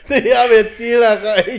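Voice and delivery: langsame, laute Navi-Ansage; GPS-Stimme. Pretend. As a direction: wie ein Navi ansagen. Imitating navigation app announcement.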